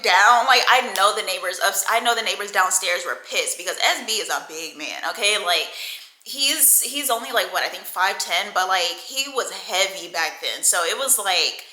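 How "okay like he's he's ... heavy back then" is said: ordinary talk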